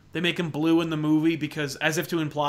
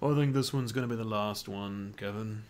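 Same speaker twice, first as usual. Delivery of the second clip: Alan Rickman impression; 8 semitones lower, 6 dB quieter than usual. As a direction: murmur it low like Alan Rickman. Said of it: "He goes".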